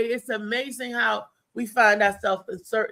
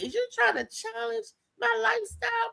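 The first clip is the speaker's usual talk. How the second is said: sassy; 12 semitones higher, 6 dB quieter than usual. Retold or imitated. Quoted challenge.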